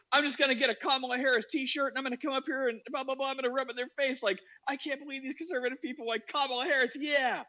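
high-pitched imitation of a friend ranting; mock defiant rant. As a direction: play his fired-up indignation.

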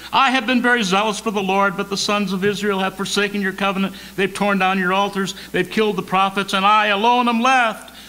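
acted-out aggrieved prophet; same old complaint. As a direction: rattle off the familiar lament, urgently. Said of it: "same song, second verse".

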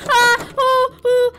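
repeated high, loud shrieks; terrified screams. Real, not acted. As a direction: scream over and over in panic. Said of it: "that's terrifying".

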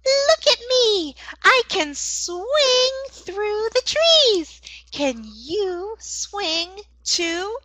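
high-pitched, drawn-out monkey voice; sing-song storybook monkey, slow and high; boastful monkey. Acted. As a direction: stretch it out high like a show-off monkey in a children's story. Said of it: "Monkey says".

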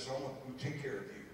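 gentle coach. Retold or imitated. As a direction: speak softly to the boy, kindly. Coach reassuring the boy.

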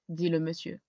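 plain reading voice

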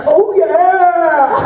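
high, gleeful cartoon cat shout. Imitating the cat that suddenly yells oh yeah. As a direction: blurt it like a surprise cat. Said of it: "and it's like".

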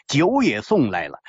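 usual speaking voice

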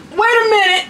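high, startled wait a minute; a jolt of realization; sudden alarm. Real, not acted.

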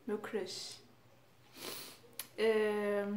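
normal talking voice

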